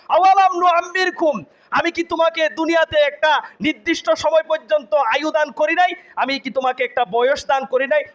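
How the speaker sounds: high, forceful preaching questions; impassioned rebuke. Acted. Allah questions the damned about their lifetime, high-pitched rebuke. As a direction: demand an answer, pitched well up.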